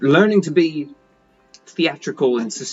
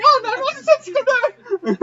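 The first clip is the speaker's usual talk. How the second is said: very high, fast comic squeal; hammed-up panic; much faster than usual. Halfling startled by a tentacle, squeaky mock panic.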